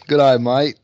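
a mock Australian greeting, loud; hammed-up Aussie "Good eye, mate"; Australian accent. Jokey Aussie greeting after trip.

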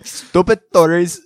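cracking up mid-phrase; laughing. Real, not acted. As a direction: giggle through the words.

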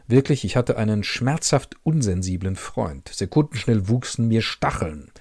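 calm literary reading, first person; story narration. A narrator's reading. Reader presenting a Markus Werner novel, measured narration. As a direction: read the novel's inner voice evenly.

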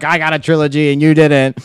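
gloating Tobey Maguire. Retold or imitated. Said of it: "he's like".

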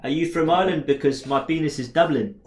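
mock chat-up line in South African accent; seductive South African accent. Using a chat-up line in the bar, mock-sexy South African accent. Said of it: "hard to seduce someone in a South African accent".

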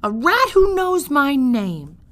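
loud, high, slow shout; delighted shout. Acted. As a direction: bellow it in wonder. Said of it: "shouted Midge".

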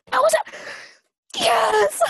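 high gasp then shouted yes; excited gasp. Real, not acted. Excited recognition of Dora.

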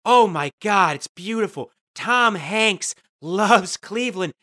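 loud, gushing mock fans, slowing on one of us; hammed-up hometown adoration; gushing Clevelanders. Cleveland fans gushing over Tom Hanks, loud and adoring. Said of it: "We go".

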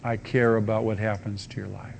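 soft, tender words to a stranger; loving invitation. Acted. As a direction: offer it gently, with care. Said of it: "with an attitude of love".

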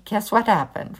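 ordinary delivery, nothing marked

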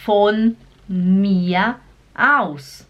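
"Von mir aus" very slow, "mir" ending in an A sound. Shown as a demonstration. Teacher demonstrates German R, "mir" slowly as "mia". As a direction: say "mir" as "mia", words spaced out. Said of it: "eles sempre fazem um som de A".

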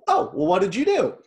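light, genuinely curious asking; easygoing, curious tone; curious, friendly question. Retold or imitated. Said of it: "as I was reading it, like, I'm sure that the intention behind it was".

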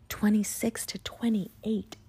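slow unvoiced whisper; slowly whispered chapter numbers. Real, not acted. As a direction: hush it like a secret.

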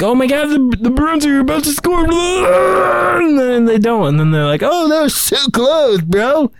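high, hyped sports announcer; mock the excited home announcer. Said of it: "they get all excited, like".

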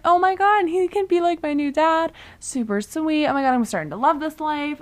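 high-pitched, giddy imitation of delight; hammed-up happy gushing; mock gushing. Acting a thrilled, naive John B.

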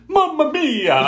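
very high, hammed-up Italian accent. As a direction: parody a bad dubber's cartoon Italian accent. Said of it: "stereotypical Italian accent that the dubber could possibly".

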